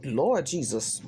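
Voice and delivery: usual speaking voice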